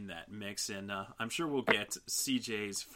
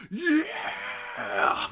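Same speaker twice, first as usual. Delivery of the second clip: high loud whoop of joy; excited cheer; 6 dB louder than usual. Retold or imitated. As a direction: cheer like the announcement just hit. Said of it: "I would have been like".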